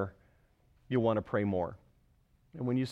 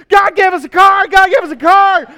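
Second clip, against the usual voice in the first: very high, loud joyful shouting; excited shout; 22 dB louder than usual. Retold or imitated. Husband shouting the news to his sleeping wife, loud and joyful.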